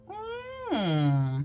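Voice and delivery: long, high, doubtful hum; pondering hmm. Retold or imitated. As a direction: draw out a puzzled hum up high. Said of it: "had me go kinda like".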